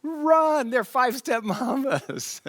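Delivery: retelling his own frantic warning about the mambas; panicked shout. Retold or imitated. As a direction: yell at everyone to flee. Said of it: "I was like".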